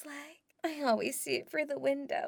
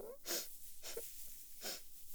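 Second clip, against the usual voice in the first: soft airy bashful giggle; a shy breathless little laugh; breathy giggle; 7 semitones higher, 14 dB quieter than usual. Acted.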